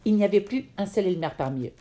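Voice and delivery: plain reading voice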